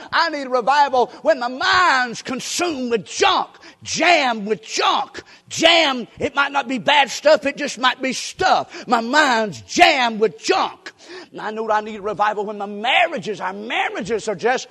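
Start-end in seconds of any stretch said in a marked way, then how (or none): 0.1-10.9 slow, loud shouted preaching; preaching at full force. Real, not acted. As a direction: hammer each word at the congregation.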